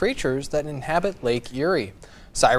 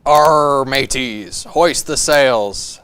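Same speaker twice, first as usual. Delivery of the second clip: loud, drawn-out pirate call; rally the crew like a playful pirate; pirate talk; 6 dB louder, much slower than usual. Pretend. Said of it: "Talk Like a Pirate Day".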